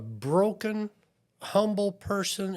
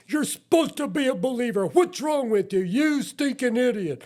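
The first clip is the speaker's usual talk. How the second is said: high, loud, fast self-berating; self-scolding; 7 semitones higher, 4 dB louder, faster than usual. Retold or imitated. A believer scolding himself after blowing it, high and loud. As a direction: snap at yourself like a disappointed coach.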